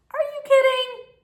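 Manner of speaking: high, loud disbelieving cry; can't believe what she just heard; shocked disbelief. Real, not acted. Reacting to the lady stealing the day bed, in high shocked disbelief.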